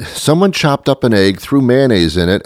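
ordinary delivery

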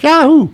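high falling jingle yell; sound-effect yodel. Retold or imitated. Host imitating Yahoo's old sound effect, high yodelled shout. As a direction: sing out the brand like a yodel. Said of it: "little sound effect that went".

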